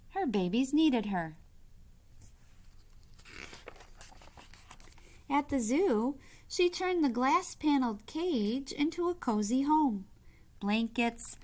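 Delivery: expressive read-aloud voice; story narration. A narrator's reading. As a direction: read it like a bedtime picture book. Reads picture book aloud.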